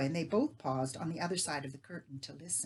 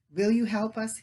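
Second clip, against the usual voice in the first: persistent; 5 semitones higher, 7 dB louder than usual. Acted. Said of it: "he persisted".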